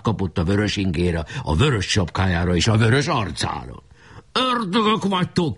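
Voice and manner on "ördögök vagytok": high-pitched cry of outrage; shout. A narrator's reading. Bombarded enemy chief cursing hidden defenders, shouting. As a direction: curse them in a high battle cry. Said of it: "kiáltott".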